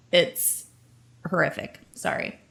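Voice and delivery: spent and emotional, dropping low and soft. Real, not acted. Ending her rant with an apology to co-hosts, emotional. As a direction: sink to a small, upset apology. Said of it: "I'm just emotional".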